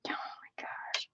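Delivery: barely voiced, slow whisper; overwhelmed whisper. Real, not acted. Overwhelmed, starting hard personal news.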